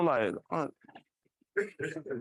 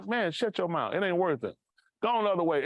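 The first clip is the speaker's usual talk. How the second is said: ordinary talk, nothing marked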